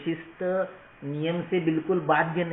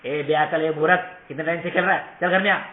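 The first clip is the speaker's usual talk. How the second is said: loud, scolding yell at a little brother; rude shouting; 2 semitones higher, 6 dB louder than usual. Retold or imitated. Elder sibling calling little brother in from the doorway, loud and scolding. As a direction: bark him home like an insulting elder sibling. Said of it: "उसको बुलाया".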